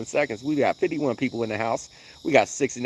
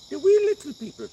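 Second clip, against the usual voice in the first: high, loud mock Irish on "wee"; hammed-up Irish leprechaun voice; mock Irish brogue; Irish lilt; 5 dB louder, faster than usual. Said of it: "My Irish was coming out a little bit".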